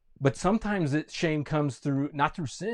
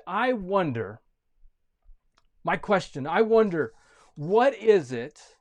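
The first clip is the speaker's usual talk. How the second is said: ordinary talk, nothing marked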